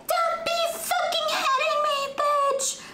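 squeaky high mock voice of the girl. Mocking the girl she punched, in a squeaky high voice. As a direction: whine it up high like a bratty girl. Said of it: "She's like".

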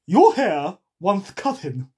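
loud, slow eccentric character; put-on loud storybook voice; Hatter. A narrator's reading. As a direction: blurt it out, drawn out. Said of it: "said the Hatter".

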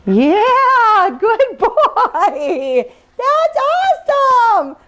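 high, bright praise for the dog; excited dog praise. Real, not acted. Praising dog after success.

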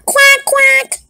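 very high squeaky quack; duck quack. Acted. Duck introduced as the neutral party, very high quack.

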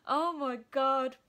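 real delight. Real, not acted. Student nurse overjoyed at her placement, slow awed exclamation. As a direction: let the joy spill out.

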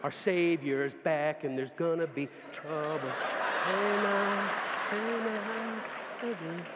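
slow, drawn out preaching with amens. Acted. Preacher proclaiming the return to the congregation. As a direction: preach it slowly to the church.

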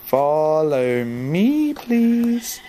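very slow plea, rising at the end; drawn-out coaxing. Real, not acted. Player luring a sheep to follow, drawn-out coaxing. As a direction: stretch each word, coaxing.

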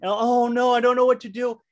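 high, loud mock panic; panicky friend. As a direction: imitate a friend who freaks out over nothing. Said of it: "they would freak out".

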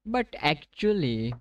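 very slow, stretched "But actually"; drawn-out actually. Real, not acted. Streamer hesitating to viewers, very slow drawn-out words. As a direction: stretch "actually" out while thinking.

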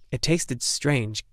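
ordinary delivery

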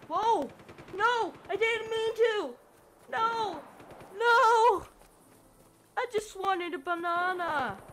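high, loud panicked cries; panicked yelling. Real, not acted. Player panicking after wrecking things, high frantic yells. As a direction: yell in real alarm at the mess you made.

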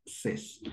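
"ses" whispered with an open e; French seize. Shown as a demonstration. Teacher drilling seize after six. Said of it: "¿cómo es que vous prononcez".

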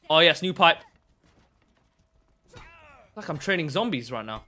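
ordinary talk, nothing marked

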